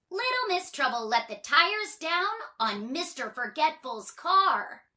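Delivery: plain reading voice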